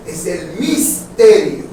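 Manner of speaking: misterio said slowly and stressed; slow corrective emphasis on misterio; corrected word, slowly. Shown as a demonstration. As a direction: draw out misterio clearly, not ministerio. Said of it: "no es el ministerio".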